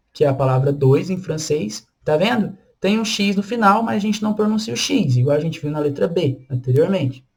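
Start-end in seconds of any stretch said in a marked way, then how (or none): none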